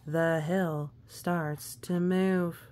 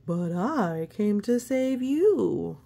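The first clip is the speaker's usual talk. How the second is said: Sir Pete; 3 semitones higher, 7 dB louder than usual. Acted. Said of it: "says Sir Pete".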